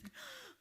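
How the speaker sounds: quick high gasp of awe; breathless gasp; sharp gasp. Real, not acted. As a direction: catch your breath in wonder. Overwhelmed recalling the ballet.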